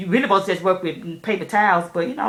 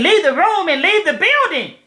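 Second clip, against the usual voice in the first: higher, louder mock instructor bark; repeated stern order; 9 semitones higher, 6 dB louder than usual. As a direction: bark the order again, fed up. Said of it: "She, again".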